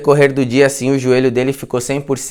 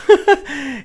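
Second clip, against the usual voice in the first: burst of laughter; 10 semitones higher than usual. Real, not acted. Teller laughing at the scene.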